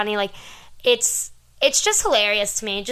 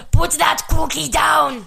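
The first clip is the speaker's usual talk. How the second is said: higher, slow shouted Arnold line; Schwarzenegger impression; 6 semitones higher, 6 dB louder, slower than usual. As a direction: bark it like Arnold. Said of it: "here's some good quotes from the movie".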